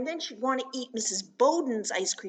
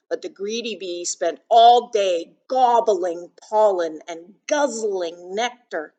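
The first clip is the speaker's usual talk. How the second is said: put-on louder, emphatic storytelling to children; picture book read-aloud; 10 dB louder than usual. A narrator's reading. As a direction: read it out big for little listeners.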